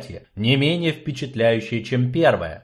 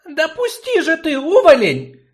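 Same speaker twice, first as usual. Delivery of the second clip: put-on angry girl; 6 dB louder than usual. Girl pulls free from the dwarf.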